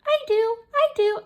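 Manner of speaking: high, squeaky little character; pitched-way-up puppet-like voice; talking letter. Pretend. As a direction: pipe up eagerly in a tiny high voice. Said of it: "Hey, who's saying that?".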